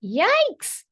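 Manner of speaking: loud, high hen squawk; startled squawk. A narrator's reading. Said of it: "squawked Missus Chicken".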